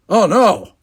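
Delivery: loud, high cry of dismay; shocked. A narrator's reading. Will learns he is due on stage, shocked. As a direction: exclaim it in shock. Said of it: "came a shocked voice".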